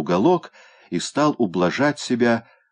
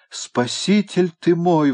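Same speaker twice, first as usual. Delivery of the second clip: exclamation; 11 semitones higher, 4 dB louder, slower than usual. A narrator's reading. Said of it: "воскликнул он".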